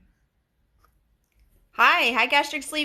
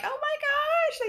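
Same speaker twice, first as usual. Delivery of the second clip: thrilled squeal. Retold or imitated. Podcaster seeing people shared her podcast, squealing with delight.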